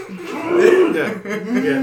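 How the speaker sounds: laughter breaking through words; trying not to laugh; stifled giggles. Shown as a demonstration. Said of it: "Everyone's just like this".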